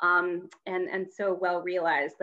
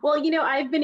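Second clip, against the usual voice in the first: usual speaking voice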